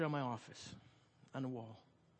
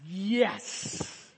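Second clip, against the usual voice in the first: louder, higher drawn-out mock cheer; triumphant; 4 semitones higher, 5 dB louder than usual. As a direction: celebrate being let off the hook. Acted relief, kid is caught.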